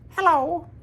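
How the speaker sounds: very high, loud greeting; high put-on hello; Bobby Justin. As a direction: greet in a high-pitched character voice. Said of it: "do a Bobby Justin impression".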